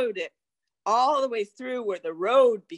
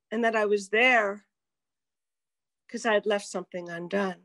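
ordinary delivery

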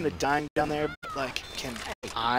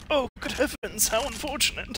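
posh mock voice; 7 semitones higher, 4 dB quieter than usual. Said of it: "you're like".

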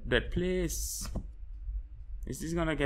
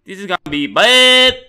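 louder, higher panicked repeat; loud dread; 6 semitones higher, 15 dB louder than usual. Real, not acted. Gamer bracing for danger, loud dread. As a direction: yell the dread out.

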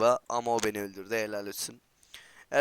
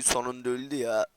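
ordinary delivery

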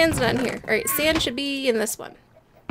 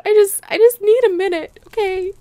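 hammed-up grief; 7 semitones higher, 8 dB louder than usual. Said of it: "I'm so sad".

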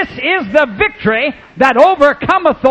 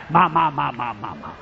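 a hint of a little boy babbling; soft, low toddler echo; lightly voiced baby; 10 semitones lower, 8 dB quieter than usual. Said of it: "I said".

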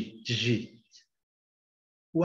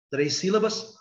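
ordinary delivery

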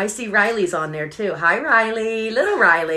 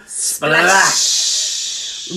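breathy splash noise; whispered splash; 4 semitones lower than usual. Pretend. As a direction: make a splash sound.

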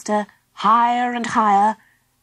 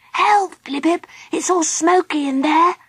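high-pitched small creature in alarm; small high voice, complaining of danger; little Baby Bee voice; 8 semitones higher than usual. Acted. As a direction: cry out high as a little frightened character. Frightened small character calls from smoky log.

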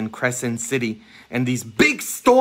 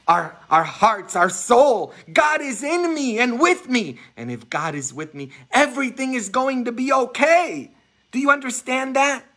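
rising fervent preaching; 12 semitones higher than usual. Real, not acted. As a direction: proclaim it with fire. Peak of preaching.

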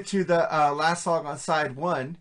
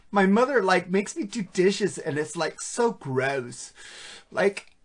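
put-on Valley Girl voice; 3 semitones higher than usual. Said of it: "Valley Girl talk".

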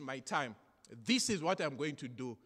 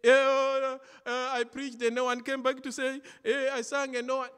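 mock complaint; 10 semitones higher, 11 dB louder than usual. Mimicking a complaining church worker.

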